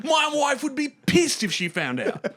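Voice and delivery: high, slow, laughing half-shout; giddy mock confession. Mimicking Hugh Jackman gleefully fearing his wife, high and laughing. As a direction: crow about the secret, cracking up.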